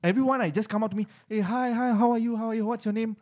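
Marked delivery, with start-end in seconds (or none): 1.3-3.1 high, chirpy mock greeting; high-pitched imitation of eager small talk; over-eager greeting. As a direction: imitate pushy newcomers firing friendly questions. Acting out over-eager young interviewees.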